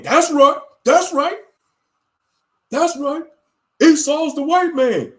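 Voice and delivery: high, loud, hammed-up chanting agreement; mocking Israelites. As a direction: ham up a preachy camp member chiming in.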